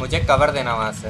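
loud call for cover; cover me shout. Real, not acted. Calling teammate for cover.

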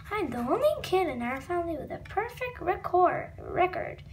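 plain reading voice

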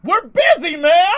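high, loud shout at someone outside; annoyed yell. Real, not acted. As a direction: yell out at the noisy worker. Yelling at the leaf blower outside.

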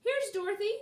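high, loud call; bright-eyed shout of announcement. A narrator's reading. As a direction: call down to a friend below. Calling down from a tower.

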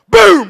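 explosive, high, very loud boom; imitated gunshot. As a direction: blast it out like a shot. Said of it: "she heard Billy go".

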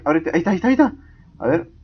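ordinary delivery

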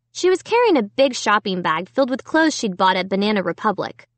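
usual speaking voice

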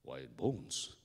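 low, puzzled echo; low-pitched adult questioning; puzzled big man. Acted. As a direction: repeat it deep, as the grown man. Neighbour echoes the crying boy.

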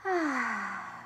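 soft long sigh out; sighing exhale. Shown as a demonstration. Mom letting out the last breath for kids. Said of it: "and let it out".

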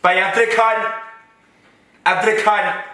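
mock-outraged tirade; indignant rant. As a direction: vent it like she's been wronged by the world. Ranting about being neglected.

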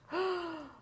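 long storyteller's gasp of alarm; dramatic gasp. A narrator's reading. Reader spotting the disguised bad guy, dramatic gasp. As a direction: gasp in mock shock.

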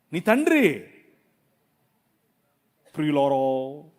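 ordinary delivery

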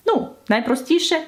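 ordinary talk

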